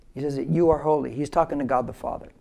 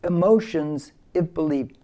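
call-and-repeat slogan; slower than usual. Shown as a demonstration. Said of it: "Say with me again".

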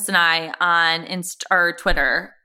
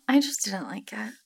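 a sigh, then a whispered confession; reluctant whispered admission; 7 dB quieter than usual. Real, not acted. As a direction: breathe it out, half ashamed. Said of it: "sighs whisper".

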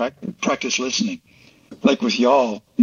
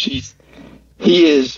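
normal talking voice

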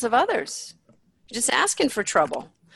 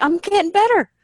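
mock-joyful voice, pitched much higher; excited clients; 11 semitones higher, 4 dB louder than usual. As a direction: gush like a thrilled client sharing good news. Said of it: "They're like".

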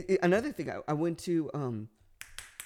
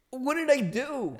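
high, loud, drawn-out protest; mock bewildered protest; 10 semitones higher, 6 dB louder, slower than usual. Storyteller protests to his friend, mock bewildered. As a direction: protest your innocence loudly.

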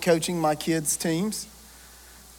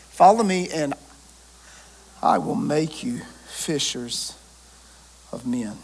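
soft, slow, lower second reading; gentle repeat; 4 semitones lower, 4 dB quieter than usual. Acted. Preacher repeating the call gently to the congregation, slow and soft.

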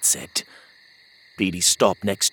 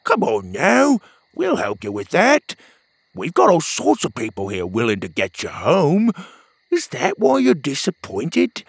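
louder, higher upbeat friend; pep up a gloomy buddy; 5 semitones higher, 5 dB louder than usual. Acted. Petey cheering up Pockets, bright friend voice.

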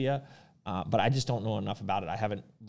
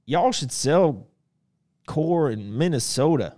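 put-on loud, drawn-out Southern accent, pitched up; mock Southern accent; 5 semitones higher, 8 dB louder, slower than usual. Host reads out a viewer's request about Minnesota, mock Southern accent. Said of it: "That's a Southern accent".

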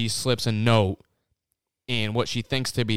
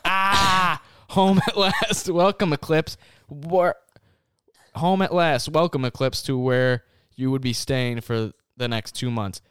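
higher, louder, slow theatrical read; melodramatic reading; 5 semitones higher, 3 dB louder, slower than usual. Acted. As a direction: narrate it like a cheesy romance novel. Hams up reading the fanfic.

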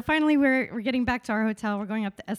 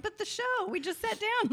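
high, softish pleading whine; hammed-up disappointed objection; mock protest; 11 semitones higher, 4 dB quieter than usual. Acted protest at leaving early.

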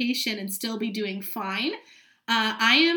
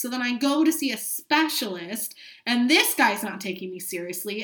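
usual speaking voice

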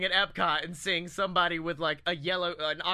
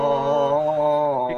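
loud drawn-out roaring sound; imitated roar; 5 semitones lower, 8 dB louder than usual. Shown as a demonstration. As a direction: let out a long loud roar. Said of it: "It sounds something like".

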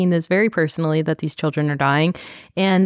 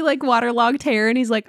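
usual speaking voice

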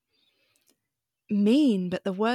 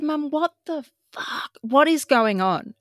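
exasperated outburst; 7 semitones higher, 4 dB louder than usual. Retold or imitated. Confronting mum about girlfriend.

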